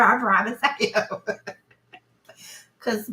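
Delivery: ordinary talk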